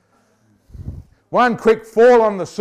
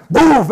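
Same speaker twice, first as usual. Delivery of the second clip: stamp sound; 8 semitones higher, 10 dB louder than usual. Retold or imitated. God stamps his foot.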